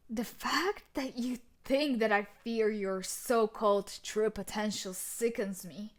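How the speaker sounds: normal talking voice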